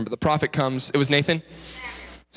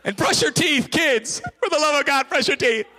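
high-pitched exclamation, far from the usual voice; mock desperate plea; 10 semitones higher than usual. Preacher begs the kids to brush, mock frantic cry. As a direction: cry out in comic exasperation.

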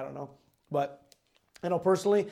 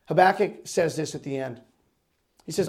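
usual speaking voice

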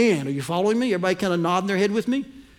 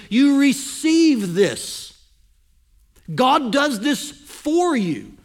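slow, higher and louder, weight on each word; emphatic preaching; 5 semitones higher, 4 dB louder, slower than usual. Real, not acted. Preacher telling the congregation strength comes from God, slow and emphatic. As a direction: drive it home slowly and forcefully. Preacher driving home main point.

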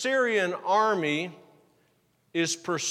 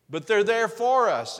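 emphatic preaching; 5 semitones higher, 6 dB louder than usual. Real, not acted.

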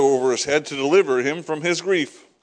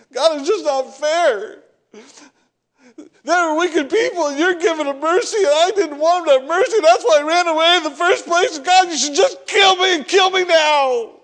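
much higher and louder, a crying shout building to kill me now; Jonah's tearful outburst; 11 semitones higher, 4 dB louder than usual. Retold or imitated. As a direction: act out Jonah sobbing and railing at God. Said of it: "That's my version".

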